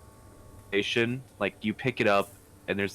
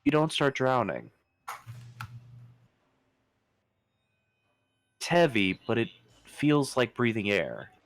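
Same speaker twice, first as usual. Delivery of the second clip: game master narration. Acted. GM narrates the underwater scene.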